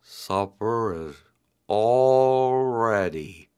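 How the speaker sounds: slow turtle voice. A narrator's reading. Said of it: "he said".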